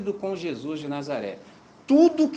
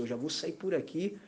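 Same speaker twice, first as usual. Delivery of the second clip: inner thought; 9 dB quieter than usual. Retold or imitated. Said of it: "Eu falei assim".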